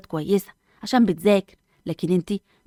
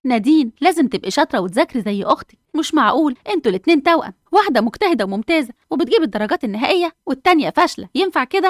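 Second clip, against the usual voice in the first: higher, scolding rebuke rising to disbelief; scolding mother; 7 semitones higher, 4 dB louder than usual. Acted. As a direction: tell her off, building up. [name] scolds lazy twin. (توبيخ أم لبنتها بصوت عالي)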